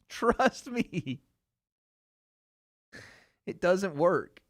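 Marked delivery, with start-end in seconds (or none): 0.1-1.2 laughing. Real, not acted. Laughs while insisting on it.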